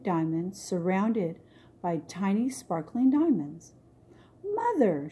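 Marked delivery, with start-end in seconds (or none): 4.5-5.1 a lifted, eager call of Mother; delighted princess calling to her mother; excited cry. Acted. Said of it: "she cried".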